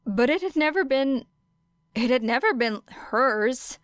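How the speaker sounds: plain reading voice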